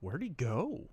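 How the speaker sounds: ordinary talk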